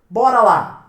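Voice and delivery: loud, punchy "Bora lá!"; energetic rally. Real, not acted. Teacher rallying students into accent rules, loud and energetic. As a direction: kick it off like a coach. Teacher launching the next topic.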